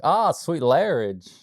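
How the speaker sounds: slow, drawn-out frat bro voice; lightly voiced party bro in a basement. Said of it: "they're like".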